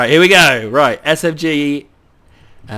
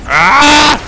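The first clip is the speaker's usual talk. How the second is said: sudden high loud yelp of alarm; scream; 11 semitones higher, 10 dB louder than usual. Real, not acted. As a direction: cry out as the tank dies. Tank being destroyed in battle.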